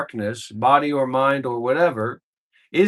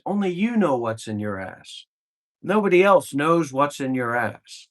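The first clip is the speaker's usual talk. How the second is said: normal talking voice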